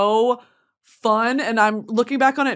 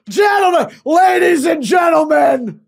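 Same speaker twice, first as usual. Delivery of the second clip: booming, drawn-out arena announcer, higher and much louder; hammed-up announcer shout; 5 semitones higher, 7 dB louder, much slower than usual. Announcing to the troops on base, hammed-up arena shout. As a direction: bellow it like a race-day host hyping the crowd. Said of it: "That was me on base".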